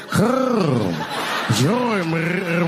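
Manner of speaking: very slow, drawn-out throaty roll; rolled throat. Shown as a demonstration. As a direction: roll your throat, stretching WATERY GRAVE. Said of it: "you gotta roll your throat".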